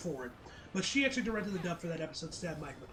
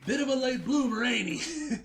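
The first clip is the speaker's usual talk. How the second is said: laughing; 8 semitones higher, 7 dB louder than usual. Real, not acted. Laughing at the twist.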